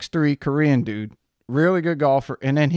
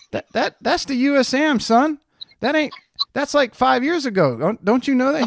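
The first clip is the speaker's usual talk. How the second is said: high-pitched retold disbelief; mock-incredulous, much higher than usual; incredulous correction; 9 semitones higher than usual. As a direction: set a clueless pal straight, voice pitched up. Retold correcting of a confused friend.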